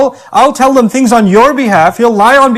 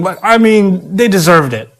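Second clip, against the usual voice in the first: normal talking voice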